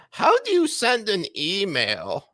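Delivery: put-on high, slow, doddering old-timer voice; mock baffled boomer, high and slow; mock confused boomer. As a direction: play a baffled old lawmaker. Mocking boomers at hearing.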